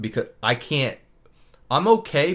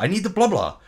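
higher-pitched mock demand; nasty; 6 semitones higher, 4 dB louder than usual. Dentist demands something of her assistant, nasty tone. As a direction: imitate a snippy order. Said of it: "they were so nasty to each other".

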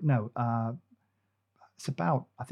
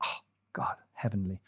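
blissful whisper; 5 semitones lower, 11 dB quieter than usual. Real, not acted. Savouring memory of chocolate.

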